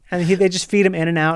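usual speaking voice

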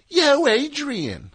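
high, slow, stretched Philly "Yo, Adrian"; Philly accent. Retold or imitated. Host showing how Rocky should sound, Philly accent. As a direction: drawl it out in a thick Philly accent. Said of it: "Should be like".

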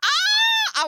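very high, piercing scream; mock-fainting fan shriek; excited scream. Screams at idea of dream guest.

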